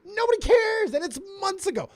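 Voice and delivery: high-pitched exasperated outburst. Real, not acted. Host ranting about months-old drama, exasperated. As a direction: exclaim it, fed up with the whole thing.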